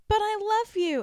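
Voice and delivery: high, loud imitation of heartbreak; hammed-up desperate cry; mock dramatic plea. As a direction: plead it like a quarrelling TV lover. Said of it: "And so she's like".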